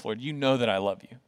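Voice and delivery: low, soft, humble confession; humbled. Acted. Peter answering Jesus after being humbled, humbled. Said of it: "Peter's humbled by Jesus".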